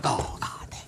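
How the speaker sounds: whispered curse from hiding; hushed dismay. Real, not acted. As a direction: breathe it under your breath. Hiding behind the pole watching.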